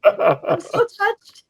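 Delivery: high, squeaky choked-up thanks; mock tearful, touched. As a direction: gush as if about to cry. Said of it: "I'm tearing up 'cause".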